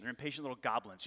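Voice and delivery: usual speaking voice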